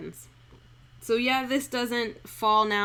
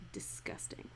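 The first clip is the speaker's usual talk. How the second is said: whisper; 19 dB quieter than usual. Real, not acted. Dog licking things.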